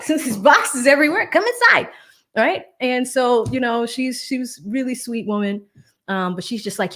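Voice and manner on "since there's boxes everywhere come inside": high, loud put-on hospitality; mock-welcoming. New homeowner excusing the moving boxes, high put-on welcome. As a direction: usher them in with a raised, louder voice. Mimics herself inviting neighbor in.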